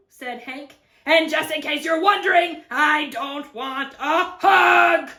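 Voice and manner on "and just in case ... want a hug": loud refusal, slowing to emphatic; grumpy. Acted. Cactus warning off the lizard, loud and grumpy. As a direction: rebuff crossly, landing each final word.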